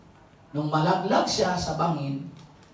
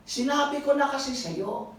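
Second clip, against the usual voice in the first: higher, mock scolding father; 7 semitones higher than usual. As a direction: lightly voice a father saying I told you. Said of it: "Sabi niya".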